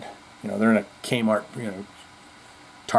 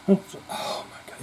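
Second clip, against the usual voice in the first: exasperated whisper; 6 semitones higher, 8 dB quieter, slower than usual. Real, not acted. Annoyed by the song.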